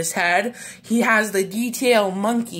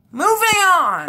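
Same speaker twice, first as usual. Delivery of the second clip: excited shout; 9 semitones higher, 4 dB louder than usual. Real, not acted. Excited transition call.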